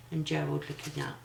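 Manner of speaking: ordinary delivery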